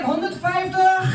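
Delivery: loud, high call of the number in Dutch; mock bingo caller shouting the bra size. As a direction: call the size out to the whole shop. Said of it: "calling out my bra size like a fucking crazy bingo caller".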